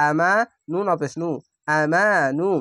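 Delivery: usual speaking voice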